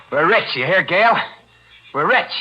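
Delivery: high, loud gloating; gleeful triumph. Acted. Killer tells Gail they're rich, gleeful. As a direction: crow it to her.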